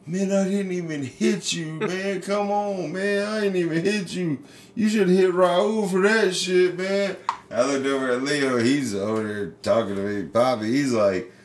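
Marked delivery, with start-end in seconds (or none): 0.1-7.2 put-on much higher, whiny voice of a crying kid; mock crying kid. Hector, winded and crying, pleading with the pitcher in a high whine. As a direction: whine it like the hurt, sobbing boy. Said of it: "he's crying, and he's like".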